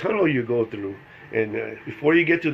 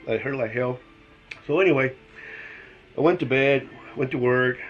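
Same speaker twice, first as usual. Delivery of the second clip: ordinary talk, nothing marked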